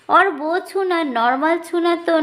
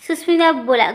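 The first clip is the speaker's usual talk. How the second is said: usual speaking voice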